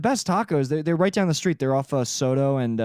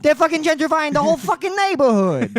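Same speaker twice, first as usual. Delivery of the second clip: higher-pitched complaining gangster voice; grumpy mobster character; 10 semitones higher, 3 dB louder than usual. Pretend. Character griping that the gang tags are gone. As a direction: grumble about the neighborhood as the character. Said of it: "that's his character".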